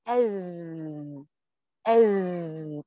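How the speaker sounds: أذ with a held stop, no bounce; clean dhal. Shown as a demonstration. Teacher showing students the fix for dhal, held. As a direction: hold the tongue tip for أذ, no echo. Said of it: "هتقولي إيه؟".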